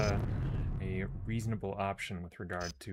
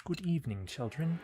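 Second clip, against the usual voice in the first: high, lilting British greeting; British accent; 9 semitones higher than usual. Shown as a demonstration. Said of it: "British accent".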